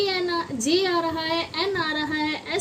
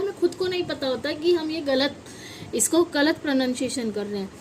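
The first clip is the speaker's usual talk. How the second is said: normal talking voice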